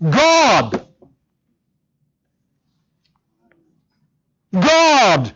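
"God" set out alone, pause after; front-loaded emphasis. Shown as a demonstration. Preacher puts "God" first to show Greek emphasis, stressed. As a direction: hold "God" up front before the rest. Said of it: "the thing emphasized is put up front".

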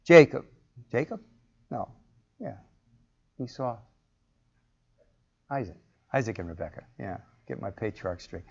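normal talking voice

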